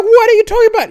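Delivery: high, loud disbelieving wife; incredulous wife. Retold or imitated. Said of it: "the woman will be like".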